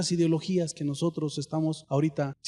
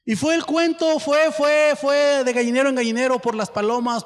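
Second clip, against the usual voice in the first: louder, high narration; spreading tale, rising; excited telling; 7 semitones higher, 5 dB louder than usual. A narrator's reading. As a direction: build the gossip spreading. Story climax, raised.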